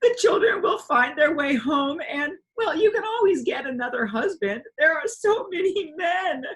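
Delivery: sniffling through sobs. A narrator's reading. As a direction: beg her through tears. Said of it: "she sniffed".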